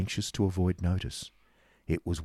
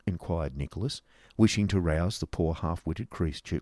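ordinary delivery

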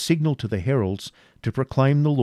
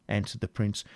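plain reading voice

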